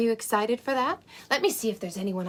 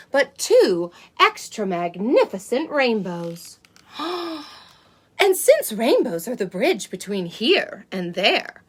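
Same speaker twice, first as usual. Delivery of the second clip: put-on lively read-aloud for small children; storybook reading; 6 dB louder than usual. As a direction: read it like a bedtime picture book. Reads a picture book to children.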